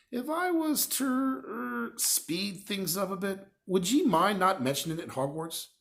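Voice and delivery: high, slow coaxing giant voice; Hagrid, coaxing. A narrator's reading. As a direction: ask it sheepishly, drawn out.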